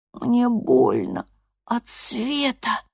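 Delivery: slow, high, soft hoarse complaint; raspy whisper. A narrator's reading. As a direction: rasp it out, weak and hurting. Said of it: "просипел Энд".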